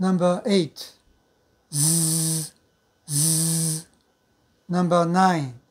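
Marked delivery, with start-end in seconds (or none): 1.7-3.9 ズー as a held consonant buzz; voiced fricative. Shown as a demonstration. Teacher drills number eight after its voiceless partner, ズー long and voiced. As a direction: voice the friction of ズー steadily on a level note. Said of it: "二つ目の黒い方は有声音で".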